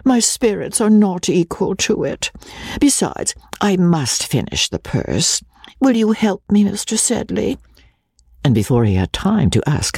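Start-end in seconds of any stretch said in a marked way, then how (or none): 0.0-7.6 high, ladylike put-on voice; coy, sighing Rebecca. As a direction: plead delicately, pitched well up. Narrator plays coy Rebecca.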